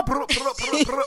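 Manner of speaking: imitated drum roll.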